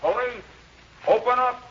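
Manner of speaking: 1930s beat cop. Acted. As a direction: bark it through the door like a cop. Police at door at night.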